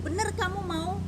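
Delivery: high, loud insistent question; retold probing question; pressing to make sure. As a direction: press hard for confirmation, pitch up. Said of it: "nanya tiga kali untuk meyakinkan".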